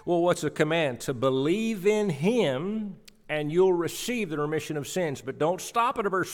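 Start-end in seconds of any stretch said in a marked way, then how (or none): none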